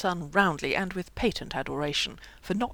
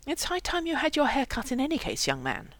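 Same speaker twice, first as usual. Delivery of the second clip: higher-pitched motherly scolding; severe; 8 semitones higher than usual. A narrator's reading. Mother scolding her son about his hair, severely. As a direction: scold him, pitched up then dropping. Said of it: "said Bevelyn severely".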